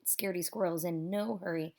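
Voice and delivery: ordinary delivery, nothing marked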